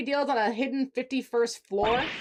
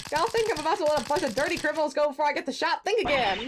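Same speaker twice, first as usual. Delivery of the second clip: high, twangy Southern reporter voice; Southern accent; 3 semitones higher than usual. Acted. Photographer catching a prosecutor red-handed, in a Southern accent. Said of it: "the one accent I can actually do".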